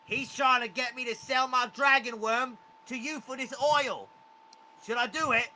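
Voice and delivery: charmed goblin anxiously asking his master. Acted. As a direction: ask the wizard for permission, worried. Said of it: "He looks to Quetzal again".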